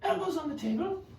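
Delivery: high put-on character voice.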